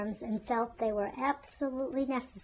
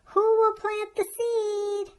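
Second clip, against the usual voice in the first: high, loud, slow call across the barnyard; 10 semitones higher, 8 dB louder, slower than usual. A narrator's reading. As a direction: hen calling out to all the animals. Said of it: "she called loudly".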